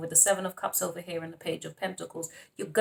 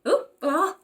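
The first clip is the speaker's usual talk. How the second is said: excited ooh; 8 semitones higher, 5 dB louder than usual. Real, not acted. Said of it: "she's excited".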